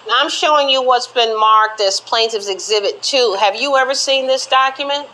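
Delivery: ordinary delivery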